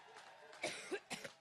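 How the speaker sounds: harsh repeated coughing; coughing fit. Real, not acted. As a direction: cough hard and can't stop. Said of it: "my allergic reaction".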